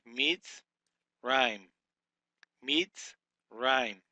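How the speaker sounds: Mitz, then Rhyme, with a clear gap; deliberate split of Mitz Rhyme; syllable by syllable. Shown as a demonstration. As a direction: sound out Mitz Rhyme in two separate beats. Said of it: "break this syllable as a Mem".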